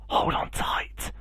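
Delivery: soft unvoiced whisper. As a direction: breathe it to the goose in secret. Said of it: "whispered Jack".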